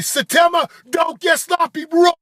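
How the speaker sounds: high shouted coaching; trainer screaming. Retold or imitated. Trainer screaming encouragement at the rower, high loud shout. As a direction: scream him on to keep form. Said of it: "And he's screaming".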